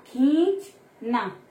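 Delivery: "खींचना" drawn out, long nasal ी; very slow demo. Shown as a demonstration. Teacher sounding out a word slowly for pupils. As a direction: stretch "खींचना" syllable by syllable. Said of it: "देखो खींचना".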